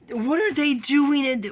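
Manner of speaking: loud, high, outraged question; sudden loud indignation; indignant outburst. Real, not acted. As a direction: blurt it out in disbelief.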